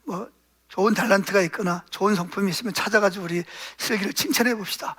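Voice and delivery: low, soft suggestion to a spouse; soft couple's resolve. Retold or imitated. Parents agreeing together to praise their son, softly. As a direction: gently propose a new plan to your husband. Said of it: "이 부부가 그때부터 서로는".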